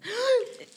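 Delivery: sharp high delighted gasp; excited gasp. Real, not acted. Spotting the prized microphone gift.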